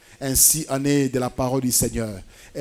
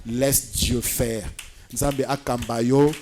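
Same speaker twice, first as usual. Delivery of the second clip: soft, unhurried pastoral comfort; quiet, calming encouragement with long pauses; gentle reassurance; 2 semitones lower, 5 dB quieter than usual. Real, not acted. Preacher reassures the waiting believers.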